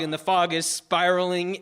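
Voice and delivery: ordinary talk, nothing marked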